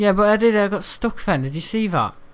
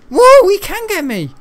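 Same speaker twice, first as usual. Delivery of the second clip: very high, loud startled yell; surprised yelp; 11 dB louder than usual. Real, not acted. Gamer caught by the enemy, startled yell. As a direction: yelp in shock.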